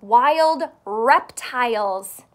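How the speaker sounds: slow and loud, stretched out; teacher demo, slow and loud; slow, loud repeat. Shown as a demonstration. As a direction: say it big and slow so they echo it. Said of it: "Can you say that?".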